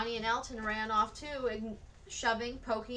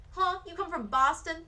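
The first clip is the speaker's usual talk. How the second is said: mocking boy; 7 semitones higher, 5 dB louder than usual. A narrator's reading. Boy mocking Frances's accent.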